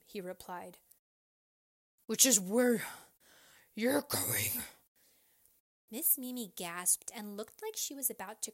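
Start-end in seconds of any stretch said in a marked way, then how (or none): 2.1-4.6 heavy, loud verdict; threatening officer. A narrator's reading. Officer telling teacher she is caught, loud menacing voice. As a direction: deliver the threat in two halves.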